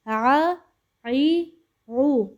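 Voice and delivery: آ، ای، او as open vowels with no عین catch; clear aa sound. Shown as a demonstration. Teacher modelling correct hamza vowels for pupils, open and clear. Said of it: "ہمزہ میں آ کی آواز نکالنی ہے".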